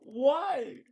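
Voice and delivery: high, drawn-out wail of "why"; anguished wail. Acted. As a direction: cry it out in mock despair. Wailing over the bad ranking.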